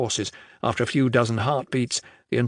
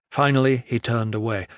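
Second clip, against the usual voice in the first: plain reading voice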